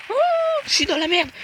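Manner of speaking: cracked panicked cry; voice cracking. Real, not acted. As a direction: voice jumps high in panic. Sudden danger in game.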